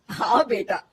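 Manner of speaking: plain reading voice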